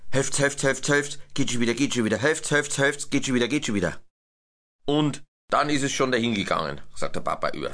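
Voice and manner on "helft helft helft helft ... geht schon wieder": chanted chugging rhythm of a train; locomotive chugging. A narrator's reading. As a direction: chant it like a steam engine puffing. Da macht der Maxl.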